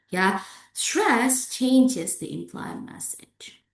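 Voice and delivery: ordinary delivery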